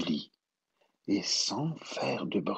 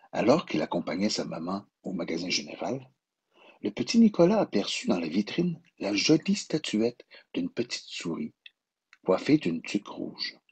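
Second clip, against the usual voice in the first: ordinary delivery, nothing marked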